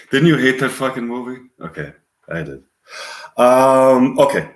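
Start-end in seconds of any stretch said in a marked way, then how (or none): none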